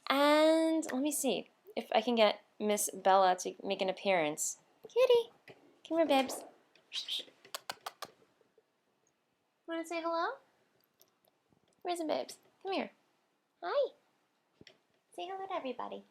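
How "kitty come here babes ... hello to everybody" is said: sing-song pet voice calling cat. Real, not acted. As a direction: coax the kitty sweetly, pitch way up. Coaxing cat on camera.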